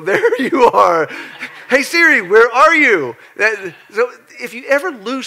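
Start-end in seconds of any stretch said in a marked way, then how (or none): 0.0-3.2 louder, laughing, calling out; laughing call to Siri. Pretend. Preacher acting out finding his phone, laughing call. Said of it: "and you go".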